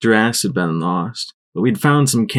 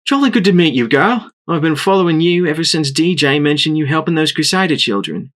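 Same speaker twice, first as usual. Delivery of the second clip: put-on higher, lightly English accented ranger; a polished Trottingham English accent; smooth English accent; 7 semitones higher than usual. A narrator's reading. Crumpets greeting Blackjack in her English accent. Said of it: "spoke with a strange, smooth accent".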